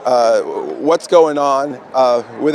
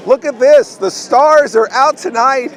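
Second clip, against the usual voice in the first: excited; 9 semitones higher, 6 dB louder than usual. Real, not acted. Greeting a guest star on camera.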